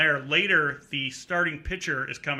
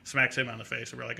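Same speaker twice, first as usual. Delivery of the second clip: plain reading voice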